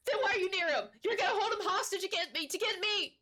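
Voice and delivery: high, frantic gnome shrieking in terror; panicked gnome. Acted. DM voices terrified gnome again.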